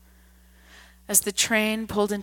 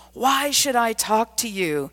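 louder, higher threatening challenge; 4 semitones higher, 5 dB louder than usual. A narrator's reading. Drunk man threatens the old gentleman, louder threatening challenge. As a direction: loom over him with the question. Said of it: "towering over him threateningly".

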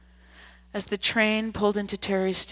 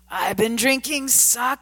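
put-on louder, higher drunken yell; 5 semitones higher, 5 dB louder than usual. A narrator's reading. Drunk man yells back at the old man, louder yell. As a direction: fling it at him like a drunk. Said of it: "the man yelled".

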